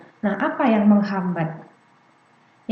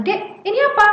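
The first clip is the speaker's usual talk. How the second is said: put-on high, raised-voice quizzing of a child; pushy parent; 11 semitones higher, 4 dB louder than usual. Parent pressing a small child to name something, insistent. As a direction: press the little one for an answer. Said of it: "orang tua terlalu sering bertanya atau memaksa anak bicara".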